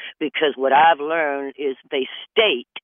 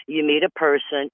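ordinary delivery

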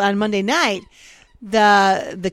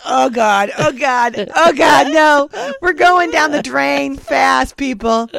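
panicked alarm; 5 semitones higher, 6 dB louder, slower than usual. Retold or imitated. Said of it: "And I'm like".

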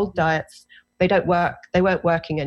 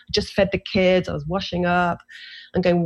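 normal talking voice

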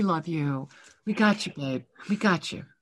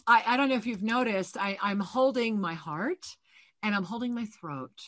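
soft channeled reading; 7 dB quieter than usual. Acted. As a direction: lay the message on her gently, hushed. Psychic soul reading, soft channeling.

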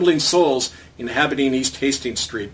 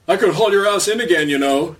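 announcing; 6 semitones higher, 4 dB louder than usual. Retold or imitated. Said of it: "his lordship announced to the Indian".

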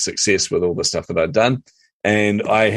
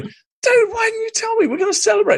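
freaking out. Retold or imitated. Hearing his friend is a published author, excited squeal. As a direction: squeal it with pure delight at the news. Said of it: "I was freaking out".

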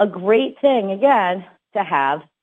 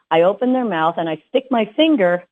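ordinary talk, nothing marked

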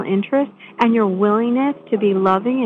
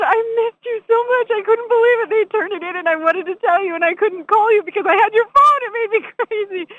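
much higher, shouting, overjoyed reunion; excited janitor; 11 semitones higher than usual. Retold or imitated. The shelter janitor greeting her after weeks, shouting with joy. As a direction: burst out with delight at seeing her. Janitor telling phone news.

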